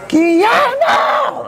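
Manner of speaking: very high, drawn-out furious yell of a name; mom storming in. Retold or imitated. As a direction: imitate an enraged wife hollering for her husband. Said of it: "comes my mom storming in".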